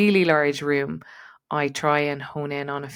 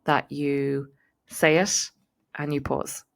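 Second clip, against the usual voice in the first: "that you say it" drawn out slowly, then a pause before "and you pause"; unhurried, deliberate pacing; slower than usual. Shown as a demonstration. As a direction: let the words land and stop. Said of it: "Pausing when you speak".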